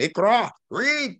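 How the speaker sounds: IQRA and READ cried out high and drawn out; high, emphatic "Iqra! Read!"; exclamation on IQRA, READ. Acted. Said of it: "with an exclamation point at the end".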